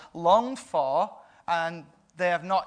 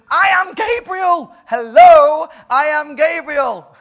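much higher, louder, slow angelic proclamation; booming Gabriel; 10 semitones higher, 14 dB louder, slower than usual. Acted. Gabriel declares himself to doubting Zechariah, booming. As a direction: announce yourself like a mighty angel.

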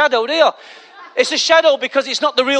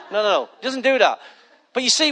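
usual speaking voice

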